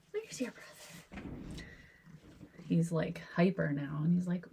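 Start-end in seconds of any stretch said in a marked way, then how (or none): 0.1-1.3 breathy high whisper. Real, not acted. Asking the cat again.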